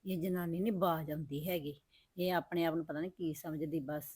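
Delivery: very quiet, bitter aside; resentful muttering. Acted. Sister-in-law complaining privately about her, muttered resentment. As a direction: grumble to yourself, hushed and resentful.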